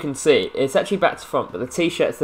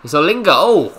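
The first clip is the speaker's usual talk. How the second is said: alarmed outburst; 5 semitones higher, 8 dB louder than usual. Real, not acted. Goal chance against him.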